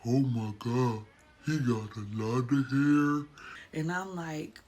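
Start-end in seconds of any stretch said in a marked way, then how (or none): 0.0-3.3 low, slow, put-on husband voice; imitating husband. Mom imitates husband seeing baby's hair, deep slow voice. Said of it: "I just hear my husband say".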